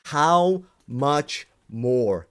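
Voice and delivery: slow, drawn-out exasperated plea. Real, not acted. Pleading with the community, slowly and exasperated. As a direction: stretch each word out in frustration. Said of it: "do I need to give to you guys".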